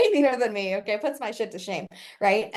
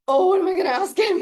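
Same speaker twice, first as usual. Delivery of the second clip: high, loud, laughing mock panic; giddy panic; 10 semitones higher, 8 dB louder than usual. Herself on getting to ask Myron one question, giddy panic. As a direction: squeal it like a starstruck fan. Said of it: "And I was like".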